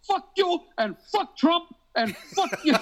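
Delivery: high, slow, mocking squeal of an angry scam caller; imitating angry caller. Retelling a scam caller cursing him out, high and slow mock. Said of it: "He goes".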